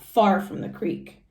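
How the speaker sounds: plain reading voice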